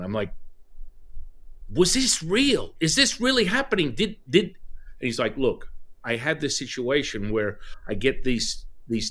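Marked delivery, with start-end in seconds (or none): 1.7-4.6 stunned excitement. Retold or imitated. Student checking whether his aura reading was real, high amazed questions. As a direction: ask it like you can't believe it worked.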